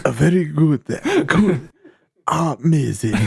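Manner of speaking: hammed-up Japanese accent echo. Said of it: "a Japanese accent voice".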